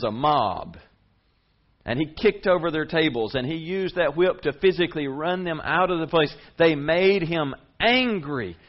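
high, loud preaching; preaching at full force. Acted. Preacher telling the congregation about the Pharisees, full-force preaching. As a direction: push the point hard from the pulpit.

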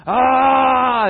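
high, very loud drawn-out yell; crowd's shout. Acted. The people shouting at the city walls, full-force yell. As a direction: let out a full-throated battle "aah". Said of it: "it is a voice that is not shouting".

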